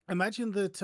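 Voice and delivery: normal talking voice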